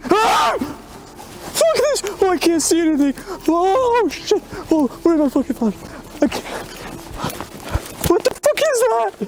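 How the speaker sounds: high panicked yelps and curses; terrified screaming. Real, not acted. Lost without a flashlight facing something, panicked screaming. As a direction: lose it completely in the dark.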